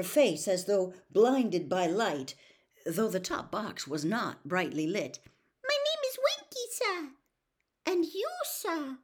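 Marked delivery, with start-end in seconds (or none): 5.6-9.0 very high tiny elf voice; Winky's quivering squeak. A narrator's reading. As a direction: squeak politely as a little house elf. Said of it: "quivering squeak of a voice".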